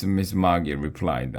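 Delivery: usual speaking voice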